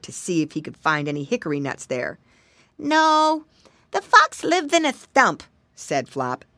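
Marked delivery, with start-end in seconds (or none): 2.8-5.4 high, loud piglet; little pig voice. Acted. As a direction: insist like a small piglet. Pig character in story.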